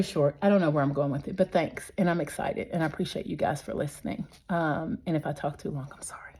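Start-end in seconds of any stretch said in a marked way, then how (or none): 5.1-6.3 soft, breathy aside with no voice; sheepish whisper. Real, not acted. A gardener apologising to viewers for rambling, whispered. Apologising for talking too long.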